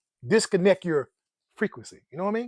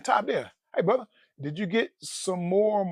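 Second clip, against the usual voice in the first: ordinary talk, nothing marked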